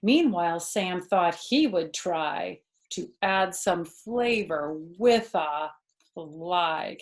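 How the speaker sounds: put-on lively read-aloud for a child; animated children's story narration; storybook reading. A narrator's reading. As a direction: read it like a bedtime picture book. Reading picture book aloud.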